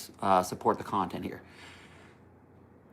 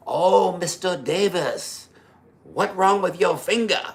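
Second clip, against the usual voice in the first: higher, louder imitation of the Korean grandmaster's accent; 8 semitones higher, 4 dB louder, slower than usual. The martial arts grandmaster scolding his student, in an imitated Korean accent. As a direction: mimic the grandmaster's Korean accent, slow on the name. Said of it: "I'm just saying this is how he talked".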